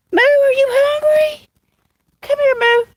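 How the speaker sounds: high coaxing cat call. Real, not acted. Owner calling her cat Moo, high coaxing call. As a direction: coax the cat over, sing-song and high.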